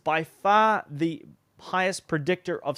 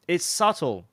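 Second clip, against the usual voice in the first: usual speaking voice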